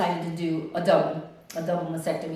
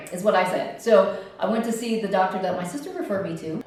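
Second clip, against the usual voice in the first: ordinary talk, nothing marked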